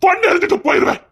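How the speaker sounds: high, loud, crying threat; husband at breaking point threatening his wife; raging threat. Acted. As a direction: blurt the threat half sobbing.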